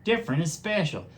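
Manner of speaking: the turtle standing up for the bunnies; Scooter the turtle. A narrator's reading. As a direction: say it gently but firm. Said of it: "said Scooter".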